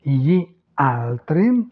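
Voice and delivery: slow "gli altri", cut into two words; words separated. Shown as a demonstration. Teacher showing beginners how to start out, words separated. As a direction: pull "gli" and "altri" apart, slowly. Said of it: "vous les séparez".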